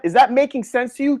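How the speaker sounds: ordinary talk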